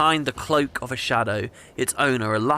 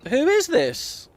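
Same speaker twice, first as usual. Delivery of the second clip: dramatic surprise; 12 semitones higher, slower than usual. Real, not acted. Reacting to the stranger.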